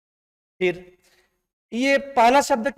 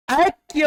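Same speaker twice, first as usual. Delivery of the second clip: ऐक्य said slowly and louder, each sound spelled out; teacher stressing the word; 6 semitones higher, 5 dB louder, slower than usual. Shown as a demonstration. Teacher drills the spelling ऐक्य to students, slow and emphatic. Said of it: "ये underline कर दो".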